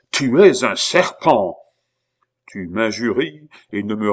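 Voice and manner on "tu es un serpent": loud, slow, drawn-out accusation; roar of anger. A narrator's reading. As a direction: bellow each word in fury. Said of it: "L'abbé poussa un rugissement de colère". (rugir de colère, lentement)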